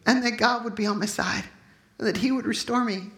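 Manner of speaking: ordinary talk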